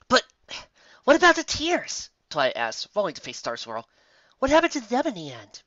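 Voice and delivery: eager and worried; anxious. A narrator's reading. Twilight anxious questions.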